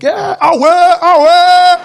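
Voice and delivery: very high, loud South African shout; joyful yell across the street; excited greeting. Retold or imitated. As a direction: hail homeboys across the street. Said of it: "I'm like".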